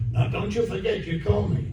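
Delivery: mock-urgent parting reminder; urging producer. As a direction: press the point in a lifted, hushed voice. Acted producer urging him to call.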